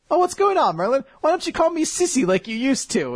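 high, hammed-up girlish whine; childish girlish voice. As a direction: beg like a little sister wanting a pet name. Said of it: "very childish and girlish side".

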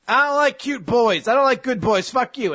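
high-pitched bratty cat voice; snotty cat. Acted. Cat rejecting the boy who rescues it, snotty high voice. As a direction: spurn the helper in a high mock cat voice.

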